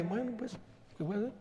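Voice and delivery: quiet, offhand shrug; dismissive dad. Retold or imitated. Father shrugging at crying daughter, quiet and offhand. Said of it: "yo estaba como que".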